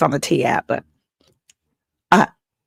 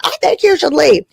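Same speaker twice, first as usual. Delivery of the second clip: higher, lightly sing-song repeat; mock repeat; 9 semitones higher than usual. Comic retelling her date, lighter higher repeat. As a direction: toss it off again, a touch higher. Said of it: "I said it like that".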